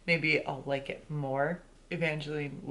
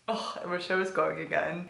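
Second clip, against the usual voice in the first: ordinary talk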